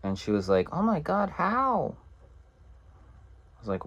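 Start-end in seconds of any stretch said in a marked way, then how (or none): 0.7-1.9 high, soft imitation of a worried woman; gasping concern pitched way up, hammed-up; mock shocked woman. Woman hearing her date got hurt, mock high alarm.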